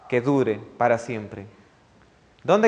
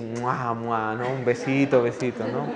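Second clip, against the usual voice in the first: mock kiss sounds; 3 semitones lower than usual. Mimes kissing with bad breath.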